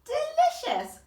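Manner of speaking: high, loud delighted cry; relishing exclamation. Acted. Mister Stink finishing his drink, loudly delighted. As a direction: savour it with gusto. Old man savours drink.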